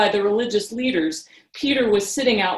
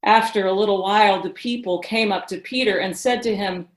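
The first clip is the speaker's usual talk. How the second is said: ordinary talk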